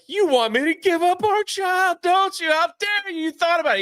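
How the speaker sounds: high, indignant mock outrage; outraged wife. Wife confronting her husband, high indignant mock outrage. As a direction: accuse him with wounded anger. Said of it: "she's like".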